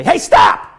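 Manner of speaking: high, loud sudden order to halt; mock alarmed shout. Shown as a demonstration. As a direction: shout it out to halt someone mid-action. Mock telling-off of shuffler.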